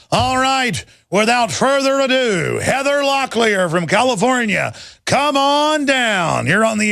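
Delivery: drawn-out announcer welcoming the caller on; game show host. Pretend.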